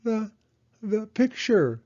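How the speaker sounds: high, slow stammer; stuttered. Acted. Hedgehog stuttering at the photo. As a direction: stumble over the words, stunned. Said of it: "Hedgehog stuttered".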